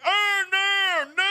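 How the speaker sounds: high, loud mock wail; imitated deaf man's protest. Deaf Bear refusing to believe the news, imitated wail. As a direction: cry out in mimicked, half-formed words. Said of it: "this guy is deaf".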